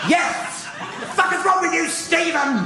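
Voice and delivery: high, hammed-up outraged sister-in-law; imitated freak-out. Brother's wife yelling at Stephen for screaming, freaking out. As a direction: scold him in a rising, high-pitched panic. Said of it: "she freaks out".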